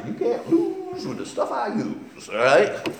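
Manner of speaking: high, laughing boxer's boast, lightly voiced; a hint of a boxer bragging. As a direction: crow it like an old boxer's catchphrase.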